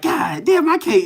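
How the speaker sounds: high, strained mock frustration; imitating himself fed up and venting; exasperated outburst. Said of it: "I used to get so pissed off 'cause I be like".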